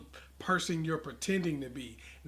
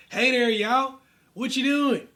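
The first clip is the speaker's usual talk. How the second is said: higher, louder Southern country drawl; country accent; 8 semitones higher, 8 dB louder, slower than usual. Shown as a demonstration. Demoing for salespeople a country Southern accent. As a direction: greet like an old country boy in a Southern accent. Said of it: "Speak in your country accent".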